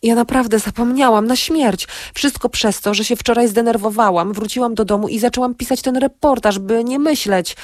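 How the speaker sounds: remorseful excuse to an upset friend; contrite excuse. A narrator's reading. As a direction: plead your case, voice kept high. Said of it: "zaczęła się kajać".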